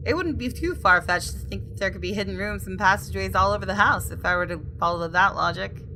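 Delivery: ordinary talk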